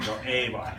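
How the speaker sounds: "A buy" as "ay bye"; "buy" rhyming with eye; pronounced like buy. Shown as a demonstration. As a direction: say "A buy" like the store Best Buy's ending. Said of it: "it's pronounced like buy, not boy".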